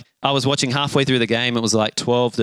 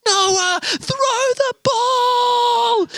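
very high, drawn-out mock yell; imitated exasperated crowd call; team yelling; much slower than usual. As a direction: holler it slowly across a whole field. Whole team calls out to Noah.

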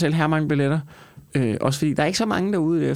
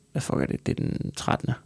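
muttered aside; 3 semitones lower, 7 dB quieter than usual. Real, not acted. Muttering to himself mid-ad.

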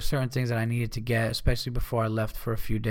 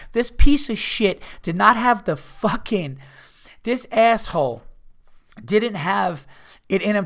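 higher and louder, seething; pissed off; 6 semitones higher, 5 dB louder than usual. Real, not acted. Ranting to listeners about the selfish stranger, higher and furious. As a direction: spit it out, still furious. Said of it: "And I'm still pissed at myself".

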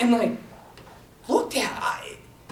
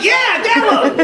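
high, laughing excitement; giddy laughing cheer; laughing eager yes; 10 semitones higher, much slower than usual. Retold or imitated. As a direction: shout it like a kid who just got a yes. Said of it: "I'm like".

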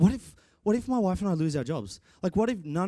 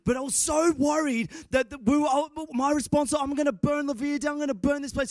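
much higher, anxious retelling; retold panic; 10 semitones higher than usual. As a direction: tell it like you're still freaking out. Retelling his amp panic.

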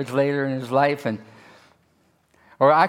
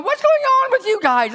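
mock surprised; 3 dB louder than usual. David voiced questioning soldiers.

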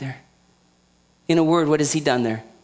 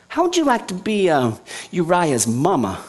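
ordinary talk, nothing marked

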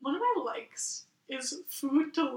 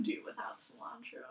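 whisper; 2 semitones lower, 10 dB quieter than usual. Real, not acted. Whispered aside.

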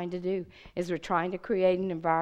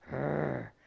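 low, quieter mock growl; a softer growled roar; imitating a growl; 5 semitones lower, 3 dB quieter than usual. Girl growling at the speaker, mild mock growl. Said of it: "growling her teeth".

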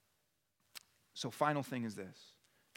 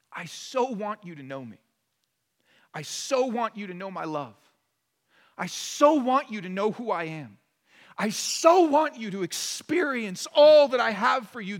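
pleading longing; 5 semitones higher, 10 dB louder than usual. Acted. Voicing Jesus' longing.

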